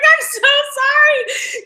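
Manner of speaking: high, slow, pleading sorry; drawn-out high mock apology; sheepish apology. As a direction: beg forgiveness in a small high voice. Apologizing after ruining take.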